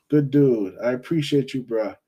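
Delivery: ordinary talk, nothing marked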